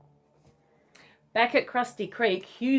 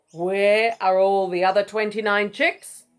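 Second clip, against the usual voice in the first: cross mother; 6 dB louder than usual. Acted. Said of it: "She looked very cross".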